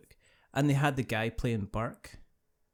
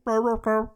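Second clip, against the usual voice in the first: loud, raised Chewbacca-style growl; imitated Wookiee growl; 7 semitones higher, 6 dB louder than usual. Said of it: "he didn't wanna go".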